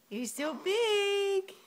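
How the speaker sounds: high, slow, crying squeal. Real, not acted. Gushing over baby.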